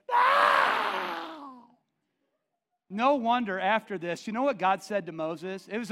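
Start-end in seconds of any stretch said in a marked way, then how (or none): 0.1-1.6 high, loud refusal; mock shout. Gift recipient refusing, high mock shout. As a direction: yelp it out in horror.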